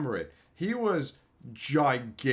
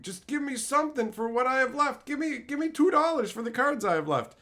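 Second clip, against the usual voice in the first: high pleading mock voice; begging crackhead; 10 semitones higher than usual. Imitating crackhead friends begging for cash, high and pleading. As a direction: whine it out like a desperate addict.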